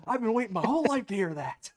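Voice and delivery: high, loud, quick mock exclamation; imagined dad's triumph. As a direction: blurt it out as the long-suffering dad. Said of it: "I'm just assuming that's what he said".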